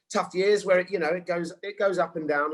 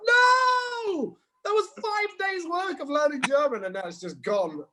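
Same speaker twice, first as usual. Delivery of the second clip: long, high shouted no into complaint; anguished protest; 13 semitones higher than usual. Retold or imitated. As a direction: wail it out in mock despair. Acting dismay at cut scene.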